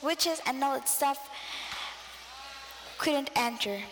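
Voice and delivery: ordinary delivery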